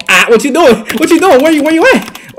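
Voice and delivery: high, loud rapid-fire questions; loud, excited heckling; taunting yell. Real, not acted. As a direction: shout it at the beaten defender. Taunting during a play.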